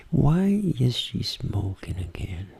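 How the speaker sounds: slow soft whisper; intimate voice. Shown as a demonstration. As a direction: whisper it slowly, just for yourself. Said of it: "Vou falar em voz baixinha". (voz íntima, sussurro lento e baixinho)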